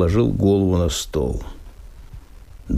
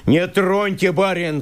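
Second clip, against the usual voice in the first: окрик; 7 semitones higher than usual. Acted. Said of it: "крикнул на меня лесник".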